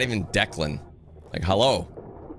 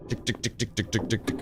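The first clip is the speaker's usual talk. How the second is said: mimicked ticking; 2 semitones lower, 7 dB quieter than usual.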